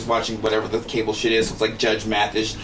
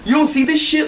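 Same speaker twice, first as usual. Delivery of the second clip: much higher outraged yell; a cop almost hit, yelling at passing drivers; high-pitched mock outrage. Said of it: "He's like".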